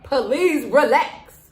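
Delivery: drawn-out pleading call outside; mock call out. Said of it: "go out there and tell them".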